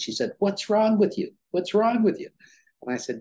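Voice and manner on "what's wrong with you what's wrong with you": wife's insistent question, voiced higher; persistent wife. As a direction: press the question again and again, a bit higher. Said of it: "But she persisted".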